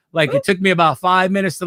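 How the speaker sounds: normal talking voice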